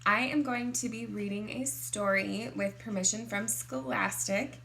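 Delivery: ordinary delivery, nothing marked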